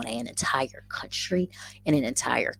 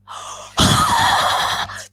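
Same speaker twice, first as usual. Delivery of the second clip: high, sharp delighted gasp; excited gasp; 6 dB louder than usual. Real, not acted. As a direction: gasp at the crowd that showed up. Sees many viewers live.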